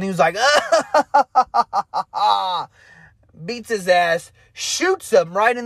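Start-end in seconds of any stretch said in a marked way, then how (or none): 0.4-2.6 mock villain cackle, pitched up; a hammed-up crazy cackle as he bursts in; maniacal laugh. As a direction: imitate the killer's crazed laugh. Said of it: "He starts laughing".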